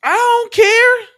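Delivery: slow, high, loud "I don't care"; a drawn-out exasperated outburst; exasperated shout. Real, not acted. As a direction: yell it, fed up. Exasperated reaction to clip.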